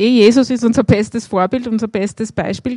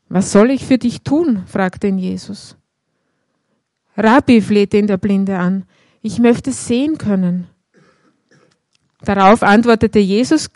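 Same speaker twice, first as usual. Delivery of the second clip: louder, measured scripture reading to the congregation; Bible reading; 5 dB louder than usual. Acted. Preacher reads the Bartimaeus story aloud to the congregation, measured. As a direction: read the passage out like a lector.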